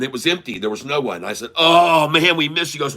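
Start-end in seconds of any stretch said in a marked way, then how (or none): 1.6-2.7 high, loud retold groan of dismay. Traveler finding the gate empty, dismayed. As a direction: cry out as if all is lost.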